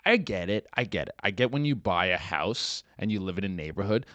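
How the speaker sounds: normal talking voice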